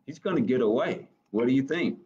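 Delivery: ordinary delivery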